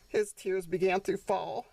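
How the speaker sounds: ordinary delivery, nothing marked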